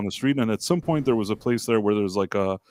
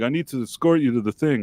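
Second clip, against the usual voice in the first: ordinary talk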